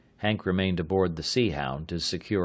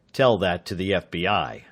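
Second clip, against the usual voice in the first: curt louder snap; snapping; 4 dB louder than usual. A narrator's reading. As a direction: cut him off sharply. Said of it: "snapped the sergeant".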